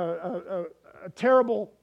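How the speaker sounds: ordinary talk, nothing marked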